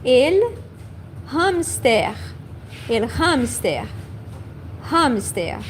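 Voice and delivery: "hamster" with a clear breathy h at the start; the h in "el hamster" fully sounded. Shown as a demonstration. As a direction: voice the h in "hamster" on purpose. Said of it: "we are going to pronounce the H".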